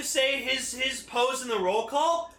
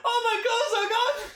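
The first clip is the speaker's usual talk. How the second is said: much higher, louder squeal with a laugh; panicked squeal; 4 dB louder than usual. Acted. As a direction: squeal it like a panicked character who lost his clothes.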